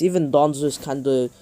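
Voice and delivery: usual speaking voice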